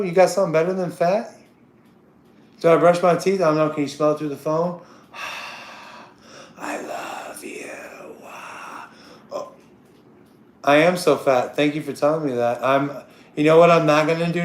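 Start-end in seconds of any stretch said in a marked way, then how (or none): 5.1-9.5 long sigh into a slow whisper; breathy whisper. Pretend. Breathing down the phone to a caller, whispered slowly.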